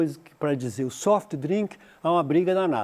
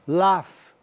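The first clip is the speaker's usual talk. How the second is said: British laugh; 5 dB louder than usual. Shown as a demonstration. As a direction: Laugh with a broad British a, like "lahf". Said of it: "britânico".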